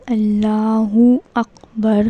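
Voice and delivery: very slow, stretched اللہ اکبر; correct pronunciation. Shown as a demonstration. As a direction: model اللہ اکبر syllable by syllable with long vowels. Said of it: "یہ اس کا صحیح pronunciation ہے".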